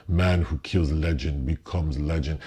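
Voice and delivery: mock heavy Russian accent, low and grave; lightly done Russian accent, mocking the film; Russell Crowe Russian accent. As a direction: imitate a bad movie Russian accent, a bit low. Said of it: "Russell Crowe with this horrible Russian accent".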